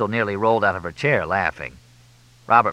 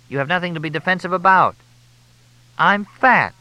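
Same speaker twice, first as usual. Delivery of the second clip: insistent female voice; 10 semitones higher, 6 dB louder than usual. A narrator's reading. Crystal insisting.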